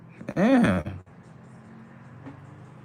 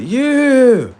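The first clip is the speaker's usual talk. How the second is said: loud, higher, drawn-out yeah; mock cheer; 7 semitones higher, 6 dB louder than usual. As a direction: cheer it like a fan. Acting out expected cheer for Christ.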